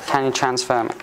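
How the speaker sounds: ordinary talk, nothing marked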